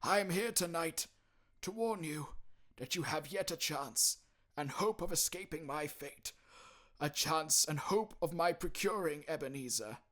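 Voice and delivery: high, loud mournful spectre; anguished ghost lament, slowing at the end. Acted. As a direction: pour out regret like a damned soul. Ghost voice in story.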